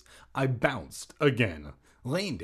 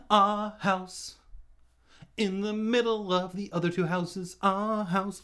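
emphatic announcing; 8 semitones higher than usual. Acted.

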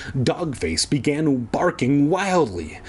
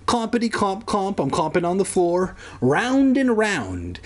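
playful singsong; 7 semitones higher than usual. Pretend. Playful aside as dog.

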